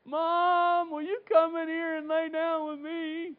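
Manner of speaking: put-on child begging Mom to stay; scared child. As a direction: whimper like a kid afraid of the dark. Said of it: "I got so scared that I said".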